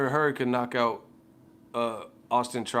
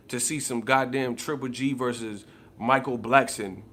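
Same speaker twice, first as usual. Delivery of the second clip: ordinary talk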